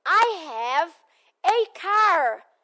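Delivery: slow, clear "I have a car" with a lift on "car"; model for repeating. Shown as a demonstration. Teacher models for students to repeat, clear and slow. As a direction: say "I have a car" for the class to copy. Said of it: "Repitam comigo".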